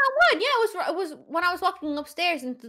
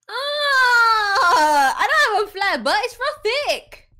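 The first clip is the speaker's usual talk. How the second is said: indignant; 6 semitones higher, 5 dB louder, much slower than usual. Real, not acted. Indignant about flat butt.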